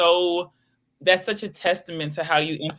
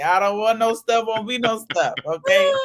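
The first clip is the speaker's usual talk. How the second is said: mock preacher; 6 semitones higher, 5 dB louder than usual. Mock preacher demanding tithes.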